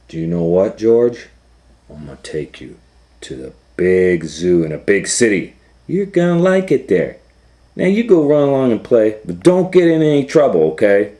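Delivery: a jolly grown-up sending the monkey off to play; the man in the yellow hat. Acted. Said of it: "and the man said".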